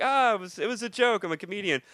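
high-pitched defensive plea; mock pleading. Comedian answering boos, high mock pleading. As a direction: plead with a hostile crowd in a high voice. Pleading with booing crowd, retold.

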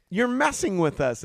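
high, loud, laughing catching-on; amused realization. Retold or imitated. Casting people catch on to his joke, retold high and laughing. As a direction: imitate someone catching on to a prank, laughing.

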